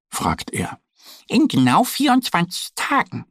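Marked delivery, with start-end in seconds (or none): 1.3-3.2 high duck voice; little duck answering. Acted. Duck Annie tells the impatient bear, in a high voice. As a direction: answer brightly, pitched way up. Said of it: "antwortet Annie".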